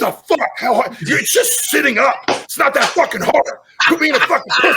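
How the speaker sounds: high, strained, exasperated yelling; mock self-berating. Humbled athlete yelling at himself in the mirror, self-berating. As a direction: chew yourself out at full pitch, hammed up.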